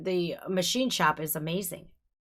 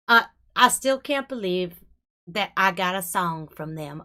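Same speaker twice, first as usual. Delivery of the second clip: normal talking voice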